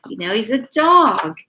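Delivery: ordinary delivery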